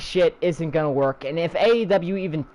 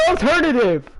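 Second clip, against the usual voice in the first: sarcastic; 5 semitones higher than usual. Real, not acted. Sarcastic echo.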